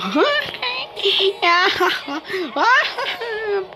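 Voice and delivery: high, hammed-up fake cackle; fake laugh. Gamer celebrating a win with a forced laugh. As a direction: laugh on purpose, clearly fake. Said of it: "Plastic ng tawa".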